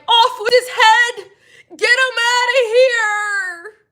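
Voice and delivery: high, loud, slow mock command laughing; laughing, shouted over-the-top command; mock royal decree. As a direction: shout the order like a queen, hammed up. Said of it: "I'ma sound like an old Italian mobster".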